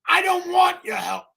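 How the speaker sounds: loud, high, indignant refusal; angry refusal. Retold or imitated. Jey shouting at his cousins, indignant and loud. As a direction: shout it back like you won't take their help. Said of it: "Jey's like".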